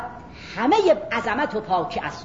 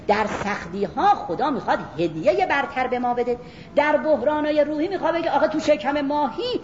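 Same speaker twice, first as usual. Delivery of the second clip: ordinary talk, nothing marked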